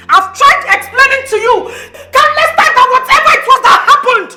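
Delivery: wronged wife insisting on being heard; exasperated protest. Acted. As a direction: argue back with mounting exasperation. Wife pleads in marital quarrel.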